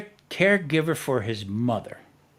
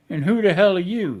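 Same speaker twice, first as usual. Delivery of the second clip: imitating his louder, higher-pitched comeback; mock indignant retort, pitched up; retold indignant challenge; 5 semitones higher, 5 dB louder than usual. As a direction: throw it back like an affronted stranger. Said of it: "He went like that".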